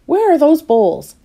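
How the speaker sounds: high, loud and slow demanding call; bossy impatience, calling out; impatient demand for the bowls. Acted. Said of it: "asked Little Miss Bossy impatiently".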